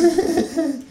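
short high cackle; a quick high snicker; high kangaroo laugh. Acted. Kangaroo laughing at the author, high cackle. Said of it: "lacht kurz auf".

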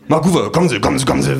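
eager agent. Acted. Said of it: "winkt mich mein Agent heran".

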